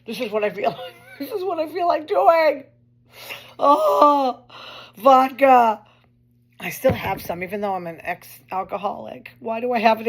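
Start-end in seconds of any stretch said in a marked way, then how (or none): 0.1-2.6 mock craving. Mimes reaching for a drink. 3.6-5.8 a higher, louder hammed-up cry of longing; mock swoon. Showing viewers the vodka with a higher, louder hammed-up cry.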